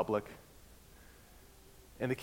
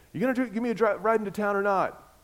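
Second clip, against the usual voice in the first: put-on drunk driver; 8 semitones higher, faster than usual. Drunk driver in a ditch asking the pastor for a ride, slurred. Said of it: "He is completely drunk".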